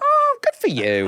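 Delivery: very high, gushing put-on; patronising. Retelling his reply to [name], very high patronising gush. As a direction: gush it down at her, squeaky. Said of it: "I went".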